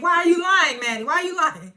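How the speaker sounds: high, loud accusing yell; hammed-up furious shriek; mock yell. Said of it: "Elder Mother screamed like".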